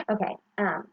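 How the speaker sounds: ordinary delivery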